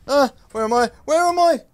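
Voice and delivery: high loud cries, rising to a scream; panicked, disoriented. Real, not acted. Player lost during a monster attack, screaming in panic.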